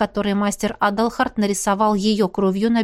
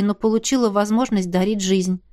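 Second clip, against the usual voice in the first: plain reading voice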